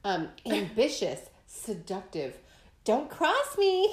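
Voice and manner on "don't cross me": slow, high, drawn-out threat; dramatic menace. Pretend. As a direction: savour each word like a warning. Repeating Scorpio slogan, dramatic.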